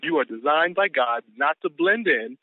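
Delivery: slow punchline. Shown as a demonstration. His own twist on quote.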